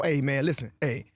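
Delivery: casual Southern buttonholing; easygoing Southern-accented patter; Southern cousin accent. Acted. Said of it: "impersonating my cousins from the South".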